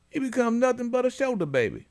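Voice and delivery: high scolding mom voice; imitating mom. Host quoting his own mother's advice, high mock voice. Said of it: "I was taught by my mom".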